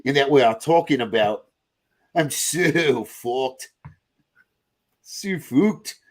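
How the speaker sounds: muddled Australian-New Zealand accent; badly faked Antipodean accent, stretched vowels; Kiwi or Australian accent. Shown as a demonstration. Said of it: "if I'm doing Kiwi or Australian".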